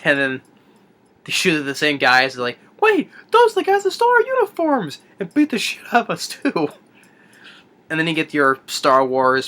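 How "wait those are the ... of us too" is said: high-pitched squawk of recognition; mock alarm. Retold guards spot the uniform thieves, comically alarmed. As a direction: act out a hammed-up, indignant outcry.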